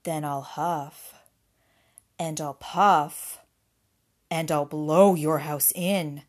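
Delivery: low, loud, slow wolf threat; threatening wolf. A narrator's reading. Wolf threatens the pig's house, low and loud. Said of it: "said the wolf".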